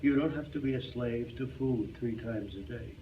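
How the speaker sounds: ordinary talk, nothing marked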